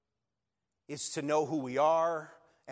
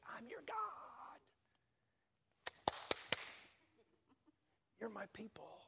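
high and much softer, gentle; tender God; 8 semitones higher, 12 dB quieter than usual. Retold or imitated. God tenderly reassuring his people, soft and high. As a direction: say it softly, like a loving promise. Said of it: "but a lot of times he says it".

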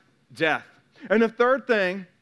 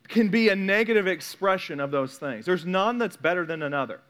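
ordinary talk, nothing marked

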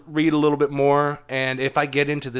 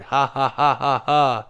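spoken laugh; slower than usual. Real, not acted. Laughing at a deep-cut joke.

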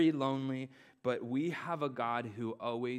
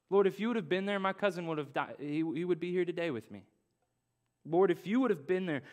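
raised pleading refrain; impassioned preaching; 5 semitones higher, 4 dB louder than usual. Acted. Pastor voices the congregation's lament. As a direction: preach the cry of loss.

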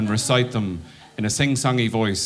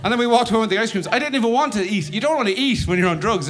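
usual speaking voice

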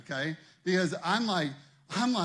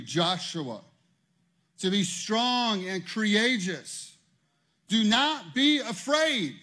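louder, higher, slow preaching; preaching at full force; 5 semitones higher, 7 dB louder than usual. Acted. Pastor urging congregation, preaching at full force. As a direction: preach it with building fire.

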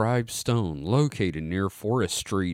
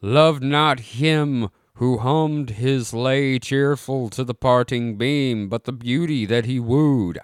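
drawn-out, formal recital; slow solemn reading; 3 dB louder, slower than usual. Acted. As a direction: read it like an old epitaph. Reading old inscription aloud.

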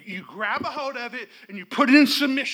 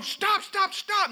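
mock stop command; 10 semitones higher, slower than usual. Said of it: "we're not going".

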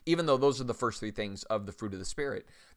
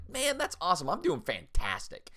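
peppy reply; 7 semitones higher than usual. Retold or imitated. Answering a gym member's good, peppy and upbeat. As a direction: jump up high on man, beaming enthusiasm.

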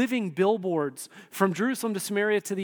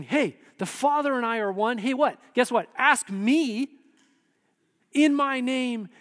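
higher, louder, emphatic invitation; eager urging; 6 semitones higher, 4 dB louder than usual. Acted. Preacher as Jesus urging disciples to ask him, eager and emphatic. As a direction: urge them like you have great news.

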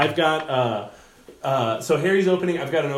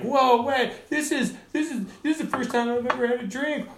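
very high, goofy drunk-guy imitation; drunk heckler; 11 semitones higher than usual. Drunk owner's kid interrupting the comic, high mock drunken voice. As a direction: mimic a ripped guy bragging, voice pushed way up. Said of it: "he's drunk, he's, like, ripped".

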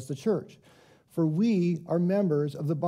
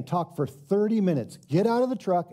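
ordinary delivery

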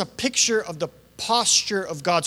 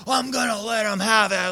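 aggressive; 2 semitones higher than usual. Retold or imitated. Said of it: "That aggressive type of mindset".

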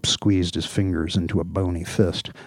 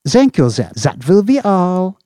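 high, loud sing-song dismissal; chiming dismissal; 12 semitones higher, 11 dB louder than usual. Acted. Chief dismissing his officer, chiming. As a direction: chirp it out to end the call. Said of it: "chimed Slopes".

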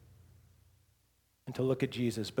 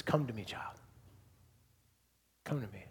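soft, hushed calling voice; low, gentle summons with long pauses; Aslan; 5 semitones lower, 10 dB quieter, faster than usual. Acted. Lion calling the child Lucy to him, softly. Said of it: "she begins to hear Aslan's voice".

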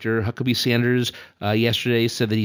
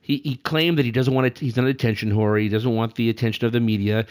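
ordinary talk, nothing marked

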